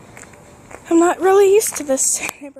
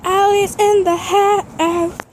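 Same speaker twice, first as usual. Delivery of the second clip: announcer sign-off; 6 semitones higher, 4 dB louder, slower than usual. Acted. Vlog sign-off catchphrase.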